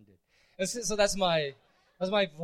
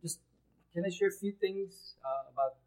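ordinary talk, nothing marked

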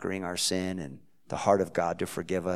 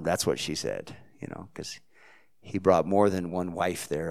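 ordinary talk

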